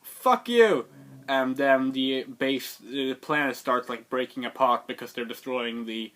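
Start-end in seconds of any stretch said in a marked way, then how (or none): 0.0-0.9 much higher and louder curse; mock shout. Rey refusing Kylo's offer, loud shout. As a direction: fling it back at him. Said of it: "And she's like".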